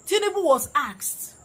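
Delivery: indignant. Real, not acted. Commentator angry at president.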